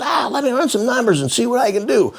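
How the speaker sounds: high, fast, slick salesman pitch; car salesman. Retold or imitated. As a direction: sell it like a pushy car dealer. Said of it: "He goes".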